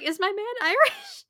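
high, loud confused question; mock-baffled at the accent. Listener baffled by the narrator's accent, high and loud confused question. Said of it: "I was like".

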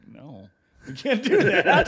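loud, high, slow mock refusal with a laugh; mock dentist refusal. Guy mimicking the dentist office refusing to reattach his tooth, loud. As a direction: drag it out laughing like a smug receptionist. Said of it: "They're like".

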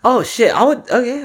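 light up at the food; excited. Real, not acted. Excited by ramen suggestion.

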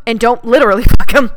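loud, laughing joke. Real, not acted. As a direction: laugh through the correction.

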